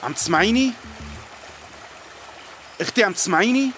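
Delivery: rising anxious calls; calling to wake her. Acted. ينادي الفتاة المغمى عليها. (نداء قلق لإيقاظ الأخت)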